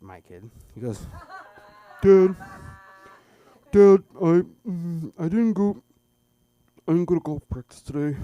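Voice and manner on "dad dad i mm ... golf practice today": much higher, loud, slowed whine; mock kid voice. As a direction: draw it out like a worried boy. Son reporting, voiced.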